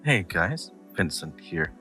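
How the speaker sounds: ordinary delivery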